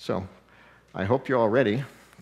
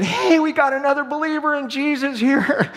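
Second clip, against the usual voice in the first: much higher, louder call across the store; imitated yell; 14 semitones higher, 6 dB louder than usual. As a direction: shout it over to a coworker, beaming. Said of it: "yelled over at another sister and said".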